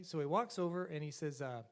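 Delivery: ordinary talk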